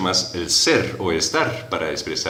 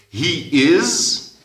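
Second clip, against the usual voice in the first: He is said high and carefully; clear model phrase; 9 semitones higher, 4 dB louder than usual. Shown as a demonstration. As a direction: lift and articulate He is. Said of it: "llega a ser". (He is, agudo y claro)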